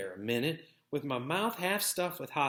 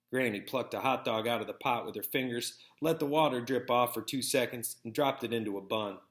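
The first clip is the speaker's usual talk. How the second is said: usual speaking voice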